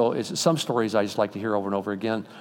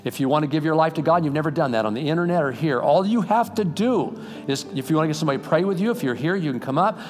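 raised, driving preacher's call to come forward; fervent altar call; 6 semitones higher, 4 dB louder than usual. Acted. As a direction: urge the crowd with rising fervor. Altar call, preaching full force.

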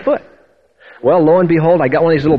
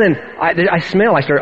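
ordinary delivery

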